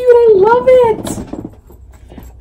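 high-pitched delighted gushing; excited squeal. Real, not acted. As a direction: squeal it, stretching the last words out. Squealing over unboxed gift.

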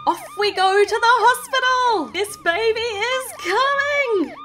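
high, loud rallying cry; excited call. Acted. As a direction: announce departure at full excitement, last word drawn out. Ambulance sets off for birth.

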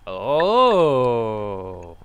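loud, gliding surprised oh; startled loud oh. Real, not acted. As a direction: gasp it out in surprise. Reacts to confession.

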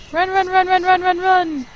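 frantic high shouts of run; yelling while fleeing something; being chased. Pretend. Fleeing the ghostly figure in game.

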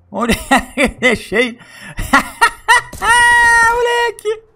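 laughing hard, high-pitched. Real, not acted. Ih, tá bêbado. (rindo muito alto)

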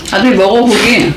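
ordinary talk, nothing marked

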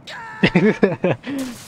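high giggling burst; amused laugh. Real, not acted. Player failing the jump, high falling laughter. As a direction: laugh at your own failure, high.

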